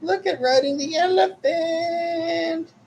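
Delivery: very high and slow, cartoonish; drawn-out squeaky play voice; high silly voice. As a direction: squeal it out like a delighted child.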